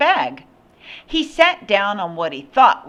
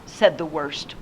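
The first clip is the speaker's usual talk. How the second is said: plain reading voice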